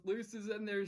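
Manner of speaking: ordinary talk, nothing marked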